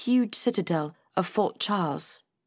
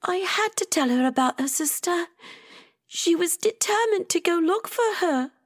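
high-pitched, weepy character voice; tearful; 9 semitones higher than usual. A narrator's reading. Rosie tearfully explaining herself after blowing her nose, tearful. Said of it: "Rosie blew her nose".

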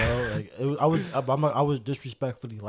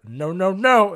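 higher, louder no-no-no through laughter; louder, laughing mimicry of a woman objecting; retold cry of protest; 5 semitones higher, 10 dB louder, slower than usual. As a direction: imitate her protest, half laughing. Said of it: "She's like".

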